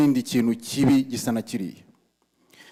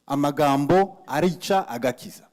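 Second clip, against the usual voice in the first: usual speaking voice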